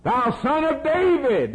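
high, sustained cry. Acted. Beggar calling to Jesus by title, high cry. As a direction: lift it high like a plea across a crowd. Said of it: "And he cried out".

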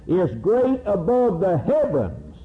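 high, lifted chant of scripture; preacher intoning psalms; psalm recitation. Acted. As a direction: sing out the verses in praise.

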